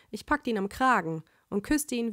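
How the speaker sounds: usual speaking voice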